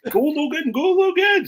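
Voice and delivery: high-pitched mock cheer from the touchline; imitated shouting. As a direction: yell encouragement like an embarrassing rugby parent. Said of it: "shouting at him".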